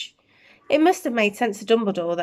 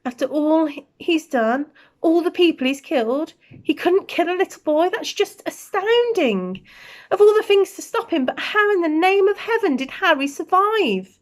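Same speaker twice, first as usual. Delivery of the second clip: higher, amazed and shaken; astonished McGonagall; 9 semitones higher than usual. Acted. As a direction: marvel in disbelief.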